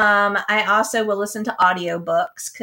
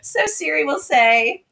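ordinary delivery

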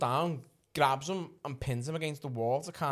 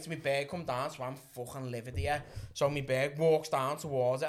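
normal talking voice

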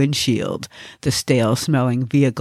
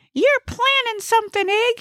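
very high, suspicious character voice; high-pitched comic accuser; suspicious Gnesi. Acted. Gnesi accuses Ig in the car, high suspicious voice.

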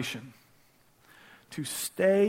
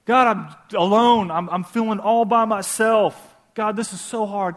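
voicing David's prayers; 4 semitones higher, 5 dB louder than usual. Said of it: "every emotion that he felt".

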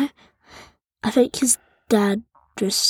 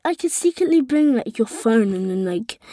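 usual speaking voice

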